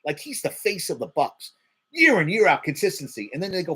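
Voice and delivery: normal talking voice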